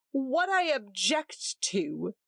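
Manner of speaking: steady deliberate objection. A narrator's reading. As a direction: measure out each word. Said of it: "said Roger steadily".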